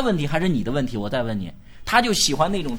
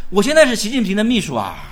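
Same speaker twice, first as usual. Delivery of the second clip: louder, announcing it boldly; proud boast to relatives; 4 semitones higher, 7 dB louder than usual. Pretend. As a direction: declare it out loud with pride. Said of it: "你就会跟他大声说".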